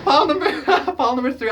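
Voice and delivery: breaking into giggles mid-announcement; high, loud laughing through the words. Real, not acted. Reader laughing at herself while calling the pile, laughing.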